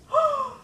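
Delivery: frightened gasp. Acted. Lady afraid.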